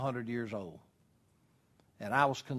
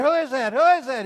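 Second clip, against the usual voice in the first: very high, loud comic yelling; mock panicked scream; 12 semitones higher, 11 dB louder, faster than usual. Man on cliff questions the voice, high loud mock scream. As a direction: shriek it like someone dangling from a cliff. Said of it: "And he screamed".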